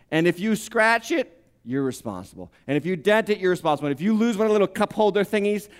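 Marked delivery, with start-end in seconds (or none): 1.6-2.5 much lower, softer punchline; preacher mocking the lease company, deadpan; dry deadpan. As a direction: drop it flat and quiet like a gotcha. Mocking the lease fine print.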